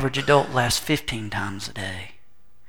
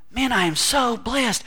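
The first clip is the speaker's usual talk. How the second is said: mock gushing believer; 9 semitones higher, slower than usual. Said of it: "they're saying".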